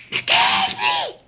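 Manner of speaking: high-pitched, drawn-out yell; screaming at top of voice. Retold or imitated. Mother screams for her daughter during robbery, full-voiced yell. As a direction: scream out the name to summon her. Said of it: "I'm screaming on top of my voice".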